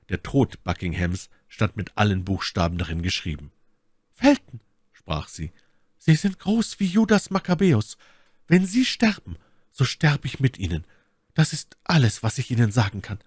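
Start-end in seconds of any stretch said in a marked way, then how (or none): 4.1-4.6 very high, loud call; fervent My Lady. A narrator's reading. Lady addressing her devoted rescuer, high fervent call. 6.0-13.2 fervent My Lady. A narrator's reading. Lady pledging herself to her rescuer, high and fervent.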